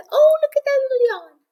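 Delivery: very high, gushing coo. Real, not acted. Owner spotting her puppy's little yawn, high cooing baby talk.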